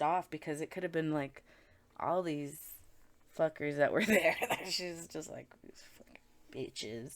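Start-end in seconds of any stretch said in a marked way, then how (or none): 5.6-7.2 slow, voiceless whisper of contempt; muttered insult. Retold or imitated. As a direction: hiss the insult under your breath, slowly. Medium voicing her angry thoughts.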